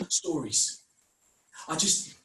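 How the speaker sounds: usual speaking voice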